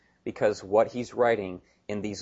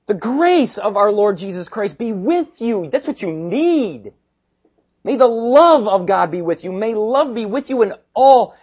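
loud, high, fervent benediction; full-force preaching; 9 semitones higher, 10 dB louder than usual. Retold or imitated. Preacher proclaims Paul's blessing over the church, full-force preaching.

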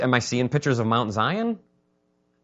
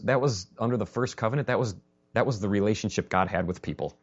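ordinary delivery

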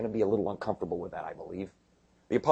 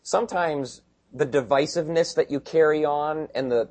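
ordinary talk, nothing marked

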